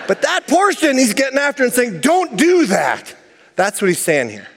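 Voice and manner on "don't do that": higher, very slow firm don't; Paul forbidding. Retold or imitated. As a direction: spell out the prohibition slowly. Paul quoted forbidding behaviour.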